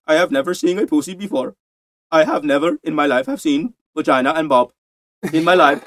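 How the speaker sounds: imitated Indian accent from a comedy film; mock Indian accent. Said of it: "Indian guy with an Indian accent".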